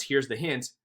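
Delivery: usual speaking voice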